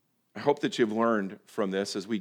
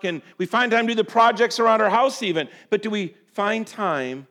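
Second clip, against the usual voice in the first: raised, loud pulpit exhortation; impassioned preaching; 8 semitones higher, 8 dB louder than usual. Real, not acted. Preacher challenging the congregation on time for worship, impassioned. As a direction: press the congregation hard, high and loud.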